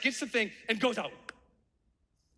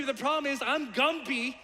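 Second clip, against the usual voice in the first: ordinary talk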